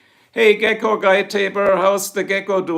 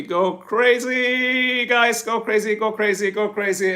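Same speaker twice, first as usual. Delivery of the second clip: long high drawn-out first cry, easing down through the repeats; hyped cheer; 5 semitones higher, slower than usual. Real, not acted. Streamer urging viewers to go crazy, hyped cheer. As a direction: stretch it out high like a party call.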